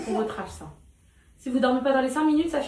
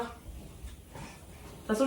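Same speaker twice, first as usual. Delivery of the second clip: whispering; 4 semitones lower, 15 dB quieter, much slower than usual. Real, not acted. Mother whispering at bedtime.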